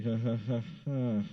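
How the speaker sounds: imitated forced laugh; fake polite laugh. Humoring the hot dog line guy, fake laugh. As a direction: laugh to humor someone, without meaning it. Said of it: "I was just laughing to make you feel better".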